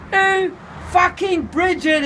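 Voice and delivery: high, loud teasing oh; mocking realisation. A narrator's reading. Voodoo teases the narrator about Bridget, high and gleeful. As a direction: crow it out in glee.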